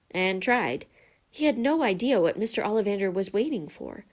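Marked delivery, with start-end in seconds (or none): none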